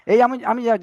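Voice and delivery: ordinary talk, nothing marked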